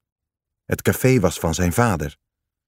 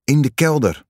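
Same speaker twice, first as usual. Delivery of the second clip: higher, urgent shout; 6 semitones higher than usual. Acted. Kees urges Sois down to the cellar, an urgent higher shout. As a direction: yell the way to shelter over the sirens. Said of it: "riep Kees".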